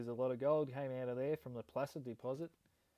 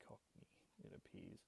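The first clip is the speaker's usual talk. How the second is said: whispered aside; 17 dB quieter than usual. Real, not acted. Aside while reading search result.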